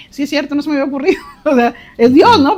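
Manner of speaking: delighted laughing aha; laughing realization. Real, not acted. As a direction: laugh out the discovery.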